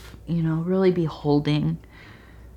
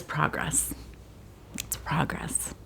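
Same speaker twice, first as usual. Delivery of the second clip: hushed unvoiced whisper; 4 semitones lower, 16 dB quieter, slower than usual. Real, not acted. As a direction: breathe it out softly. Whispered affirmation.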